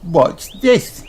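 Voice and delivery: high show-off piglet voice; proud little girl showing off; Peppa Pig. A narrator's reading. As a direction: Peppa about to jump. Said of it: "says Peppa".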